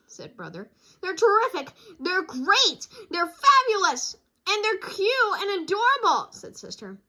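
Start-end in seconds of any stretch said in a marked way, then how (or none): 1.0-6.3 high, loud, gushing little cub; gushing child voice. Acted. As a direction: rave like a thrilled kid. Sister gushing over toys.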